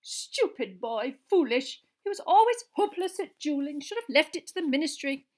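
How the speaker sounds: high, upset scolding of the dead; thick with tears. A narrator's reading. Professor mourns foolish former pupil, thickly. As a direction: grieve through exasperation. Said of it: "blew her nose and said thickly".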